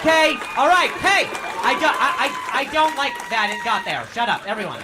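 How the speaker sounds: loud, high mock outrage; shouting at crowd. Comic scolds crowd for where it went, loud mock telling-off. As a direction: shout the audience down.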